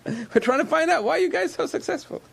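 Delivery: squeaky mimic of curious outsiders; mocking outsider quote. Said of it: "They said".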